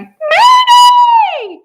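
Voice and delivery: shrill, very high, loud squawk; bird's cry. A narrator's reading. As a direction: screech it like an alarmed bird. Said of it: "The Whifflebird cried".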